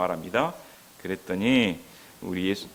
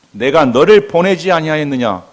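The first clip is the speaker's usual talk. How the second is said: firm divine rebuke; 2 semitones higher, 8 dB louder than usual. Acted. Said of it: "예수님은 단호하십니다".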